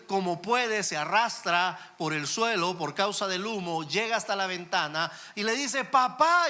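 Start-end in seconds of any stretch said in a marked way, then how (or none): 5.8-6.5 put-on high, loud, frightened child's cry; a trapped boy calling out to his father, pleading; high pleading call of a child caught in a fire; child screaming for dad. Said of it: "le sigue gritando al papá".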